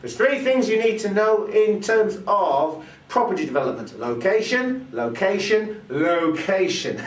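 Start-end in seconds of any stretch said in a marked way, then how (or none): 4.0-7.0 "location" repeated, stepping down from high, last one slow; emphasis by repetition. Shown as a demonstration. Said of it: "emphasise by repeating a word".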